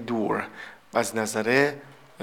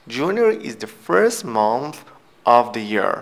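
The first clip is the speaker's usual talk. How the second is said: very slow reading; 4 dB louder, much slower than usual. Shown as a demonstration. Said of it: "teacher reads example slowly".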